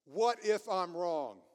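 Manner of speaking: loud, slow, deliberate question; emphatic modelling. Shown as a demonstration. Preacher leading congregation to say it with him, emphatic modelling. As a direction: give each word full weight for them to repeat.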